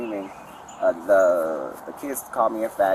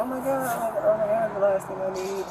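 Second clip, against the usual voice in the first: much higher, exasperated oh my God; mock shock; 13 semitones higher, 3 dB louder than usual. Woman telling police how she reacted to his threat, high disbelief. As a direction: squeal it out in disbelief. Retelling her shock at him.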